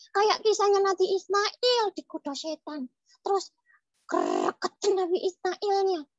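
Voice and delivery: put-on high, eager child character voice; puppet child storytelling. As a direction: tell the tale as a little puppet kid. (suara boneka anak bercerita)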